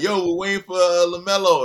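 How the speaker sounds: put-on high, loud eager kids; excited kids waiting outside the facility; kids calling out. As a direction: call out to him like hopeful kids. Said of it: "They were like".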